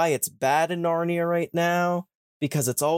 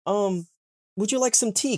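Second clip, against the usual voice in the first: higher, friendly mock voice; lightly voiced eager host; chirpy faun host; 8 semitones higher than usual. Mister Tumnus offering Lucy tea, higher and chirpy. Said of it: "he's like".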